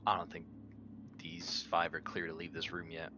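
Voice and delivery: usual speaking voice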